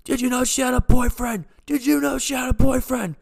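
high, loud acted scream; screaming jealous boyfriend. Boyfriend attacking the man in her bed, high screamed accusation. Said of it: "He's screaming at me like".

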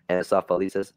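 usual speaking voice

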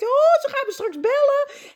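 hoge, opgewonden gedachte; nerveus opgewonden. Pretend. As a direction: klink zenuwachtig en hoopvol. Imagined nervous inner thought. (zenuwachtig en hoog)

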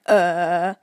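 a low, loud filler uh; low drawn-out uh. Retold or imitated. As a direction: grunt out a low uh. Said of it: "mostly just me going".

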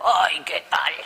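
breathy whisper, voice dropped away; a grudging hello whispered under her breath; whispered greeting. Retold or imitated. Whispering a grudging hello to the insistent brother. Forced greeting after inner thought.

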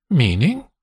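lightly voiced woman, prickly; spiky. A narrator's reading. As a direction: bristle at the remark. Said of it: "she says, spikily".

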